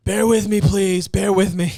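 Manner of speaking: loud, high mock pleading; put-on character voice. Comic begging listeners to bear with him, loud high voice.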